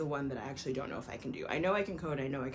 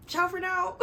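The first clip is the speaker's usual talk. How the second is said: playful sign-off; 6 dB louder than usual. Real, not acted.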